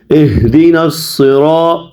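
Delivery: slow, measured recitation with drawn-out vowels; Quran recitation. Shown as a demonstration. Teacher models the verse for students, slow recitation. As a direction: recite slowly and evenly, stretching long vowels.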